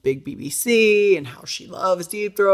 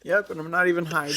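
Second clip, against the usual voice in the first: ordinary delivery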